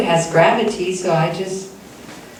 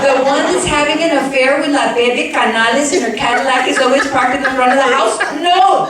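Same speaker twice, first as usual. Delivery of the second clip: scandalized protest; 6 semitones higher than usual. Retold or imitated. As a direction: rattle it off scandalized, then shout the no. Retold protest continues.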